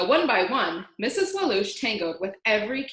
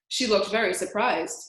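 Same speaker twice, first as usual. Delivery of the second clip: ordinary delivery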